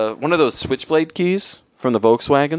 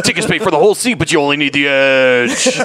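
monster truck ad. Acted. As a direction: sell the rally seats. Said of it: "That was the voice you did".

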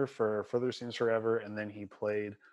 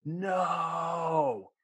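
mock crowd yell; 7 semitones higher, 3 dB louder than usual. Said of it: "the crowd overwhelmingly was just like".

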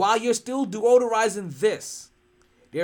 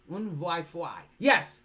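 usual speaking voice

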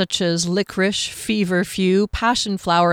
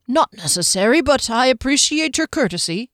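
stiffly; 6 semitones higher, 4 dB louder than usual. Acted. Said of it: "a bit stiffly".

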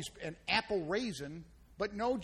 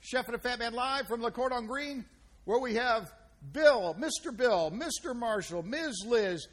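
upbeat, louder show-host intro; announcer intro; 4 semitones higher, 4 dB louder than usual. Acted. Host opening the Chef and a Fat Man show, upbeat announcer voice.

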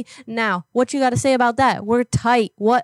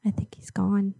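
hushed whisper; 3 semitones lower, 6 dB quieter than usual. Real, not acted. Caller checks if the man hung up, in a whisper. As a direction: whisper so the line can't hear. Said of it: "whispers after call".

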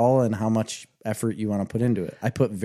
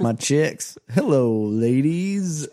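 higher, hammed-up hello; put-on flirty greeting; 5 semitones higher, much slower than usual. Man greeting his chicks as ladies, hammed-up voice. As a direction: swoop up on a mock-smooth hello.

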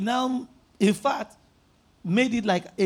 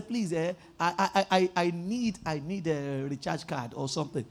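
mock begging; 6 semitones lower, 6 dB quieter than usual. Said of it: "They say".

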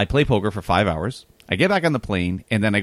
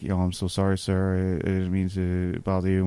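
sheepish apology; 6 semitones lower, 6 dB quieter than usual. Retold or imitated. Apologizing to the angry wrestler.

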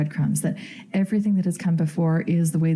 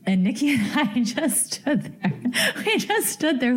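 laughing while telling; 7 semitones higher than usual. Real, not acted. Laugh heard while retelling.

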